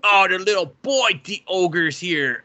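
lightly voiced show announcing the ogre; a hint of hammed-up presenter; mock narrator voice. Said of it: "And they were just".

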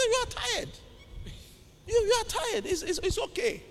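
high, soft put-on voice; acted high-pitched hushed reassurance; retold soothing reply. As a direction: softly humour a tired patient, pitched high. Retold exchange with the tired man.

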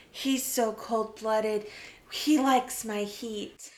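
low, slow, mock-seductive read; slow, low mock-sexy ad line; sultry blurb voice. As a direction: purr it like a cheesy romance tagline. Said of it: "being like".

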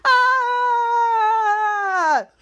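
long high scream, sliding down; acted wail of someone falling away. Hero thrown into the sewer, falling scream.

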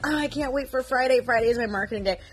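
light, lifted anticipation; mild retold excitement. Telling her supervisor about her favourite day, mildly excited.

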